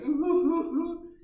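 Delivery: high, loud hooting sobs, hammed-up; mock wailing sobs. Mocking weak people crying, loud high mock wail. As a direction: wail it like an overdramatic crier. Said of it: "They cry like".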